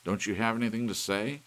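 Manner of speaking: loud, high and insistent; man yelling at his cuckoo clock; angry demand. A narrator's reading. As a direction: press the clock hard for a reply. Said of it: "he demanded".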